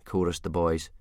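ordinary delivery, nothing marked

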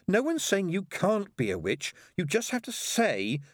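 put-on high, exasperated girl; high-pitched pleading explanation; desperate. A narrator's reading. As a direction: reason urgently with a stubborn little sister. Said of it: "said Pepper desperately".

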